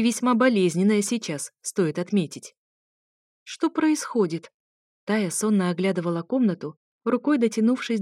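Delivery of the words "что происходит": higher, slow sleepy woman's voice. A narrator's reading. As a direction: wake up confused and ask slowly.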